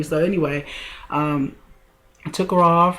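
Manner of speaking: normal talking voice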